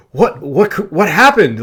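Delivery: mock-frantic retold wife; panicked wife. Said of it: "she looks scared".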